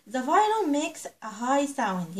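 put-on each word drawn out slowly for young learners; slow teaching read. Shown as a demonstration. Teacher reading English slowly to her little pupils. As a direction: read it slow and clear for the children.

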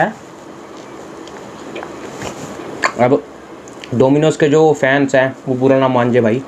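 chewing while talking; speaking between bites; mouth full of pizza. Real, not acted. Seen 1: bites slice at -3s, lips pursed chewing while talking.

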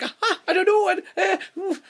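very high, loud laughing fluster; mock flustered laugh; flustered giggle. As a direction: giggle helplessly, stumped. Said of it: "she's like".

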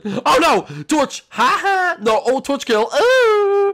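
loud, high excited yelling; excited shout. Real, not acted. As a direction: whoop it up. Excited kill callout.

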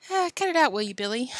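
uneasy chuckle round the words; high giggle, then a weak protest; nervous chuckling. A narrator's reading. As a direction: laugh nervously while pleading lightly. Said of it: "chuckling nervously".

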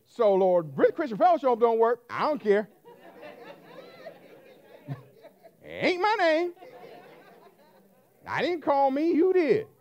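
loud, defiant address to God; fervent preaching prayer; bold prayer. Real, not acted. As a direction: challenge the Lord loudly.

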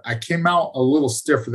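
ordinary talk, nothing marked

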